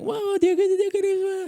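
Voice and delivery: put-on very high, loud squabbling kids; loud high-pitched mock quarrel; mimicked children fighting. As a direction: imitate squealing kids in a shouting match. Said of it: "Dia, mereka lagi perang begitu".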